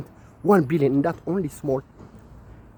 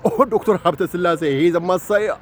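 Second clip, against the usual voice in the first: mock admirers; 5 semitones higher than usual. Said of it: "they say".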